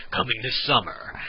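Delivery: a hint of movie-trailer announcer. Shown as a demonstration. Showing viewers the announcer part, lightly voiced. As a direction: read it lightly like a trailer tagline. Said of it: "a movie announcer voice".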